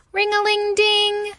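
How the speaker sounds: high sung-out alarm bell; ringing alarm. A narrator's reading. Narrator ringing the fire alarm bell at night. Alarm sound in picture book.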